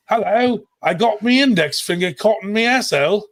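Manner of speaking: mock British accent. Teasing the absent UK guys in an over-the-top British accent. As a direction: ham up a silly English bloke. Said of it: "so I can be like".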